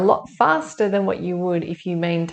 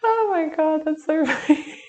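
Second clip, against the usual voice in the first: higher, giggly laughing words; 10 semitones higher than usual. Real, not acted. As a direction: laugh right through it. Laughing about the cat.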